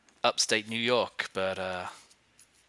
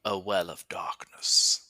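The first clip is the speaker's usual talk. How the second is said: slow, spooky whisper; hushed voice of doom; ominous whisper; 3 semitones lower, much slower than usual. Pretend. Ominous remark on the well.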